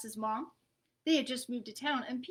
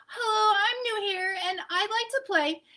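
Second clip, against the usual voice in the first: a touch shaky, hopeful; mildly nervous eager kid; nervous greeting; 8 semitones higher, 5 dB louder than usual. Acted. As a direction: take a breath and push it out nervously. Nervous final try after deep breath.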